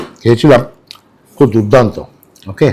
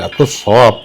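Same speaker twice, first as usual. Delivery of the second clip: ordinary delivery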